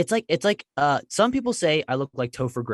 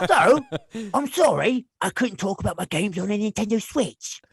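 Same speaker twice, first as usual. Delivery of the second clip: put-on British accent, pitched up then sinking; Nigel. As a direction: sign off as a cross British schoolboy. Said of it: "he'd end the video like".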